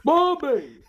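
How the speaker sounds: high, loud yell; Hank Hill yelling Bobby. Retold or imitated. Father yelling for his son as Hank Hill. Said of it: "that's his Hank Hill".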